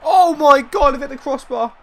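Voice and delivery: high-pitched exasperated cry; agonised disbelief. Real, not acted. Gamer who has hit the crossbar, agonised. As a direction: wail at a near miss.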